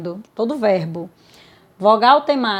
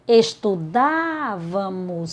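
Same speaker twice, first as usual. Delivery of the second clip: "Estudávamos" very slow, syllable by syllable, leaning on DÁ; stressed syllable shown; 4 semitones lower, 4 dB quieter, much slower than usual. Shown as a demonstration. Teacher shows the tonic syllable, "Estudávamos" drawn out on DÁ. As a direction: stretch out the "dá" in "Estudávamos". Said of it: "Sílaba tônica".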